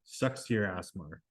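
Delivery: slightly mechanical, low and soft; mechanical response. A narrator's reading. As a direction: toss it off softly as an automatic reply. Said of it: "The response was mechanical".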